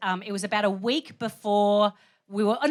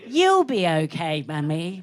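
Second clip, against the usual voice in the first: put-on slow, soothing child's reassurance; little posh son; 4 semitones lower, slower than usual. Five-year-old reassuring desperate mum, slow posh child voice. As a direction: stretch it out, falling away gently at the end. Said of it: "And he said".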